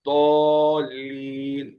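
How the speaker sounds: "ضالین" stretched very slowly on a level tone; drawn-out recitation demo. Shown as a demonstration. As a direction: hold the long vowel of "ضالین", tongue edge on the molars. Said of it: "ہماری زبان داڑھوں میں لگے".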